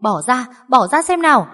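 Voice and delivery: put-on high alarmed old woman's cry; shocked grandmother. A narrator's reading. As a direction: burst out in alarm then try to part them. Grandmother finds women fighting. (bà hốt hoảng can ngăn)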